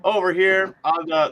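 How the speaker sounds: ordinary talk, nothing marked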